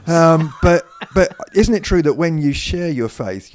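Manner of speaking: laughing through the start of the question. Real, not acted. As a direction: still chuckling at the joke, then asks. Laughing after a joke about bed.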